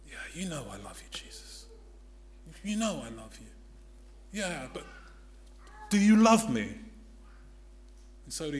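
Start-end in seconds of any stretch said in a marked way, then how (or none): 0.1-3.5 soft quick whispered answer; hushed guilty reply. Acted. Peter answering Jesus in a guilty hush. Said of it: "Peter knows about the betrayal". 4.3-6.7 pressing question. Acted. Jesus pressing Peter, building louder. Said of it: "he says".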